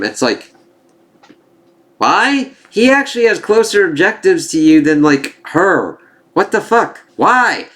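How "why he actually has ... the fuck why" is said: high, exasperated why; incredulous outrage. Real, not acted. Vlogger baffled by a pointless on-screen killing, incredulous. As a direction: sound baffled and fed up, pitch way up. Baffled by a show character's choice.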